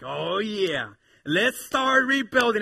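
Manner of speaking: loud, high rallying cry; eager agreement. Acted. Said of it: "like they had been waiting for".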